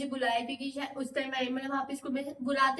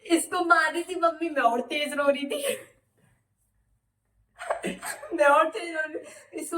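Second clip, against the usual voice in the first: laughing; 3 semitones higher, 5 dB louder than usual. Real, not acted. Laughing while retelling crying.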